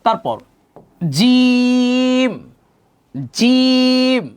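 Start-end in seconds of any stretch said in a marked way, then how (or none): 1.2-4.2 "জিম" with a hard j and long held "ee"; firm letter. Shown as a demonstration. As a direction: chant "জিম" higher, louder, drawn out. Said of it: "জিম কিন্তু শক্ত হবে".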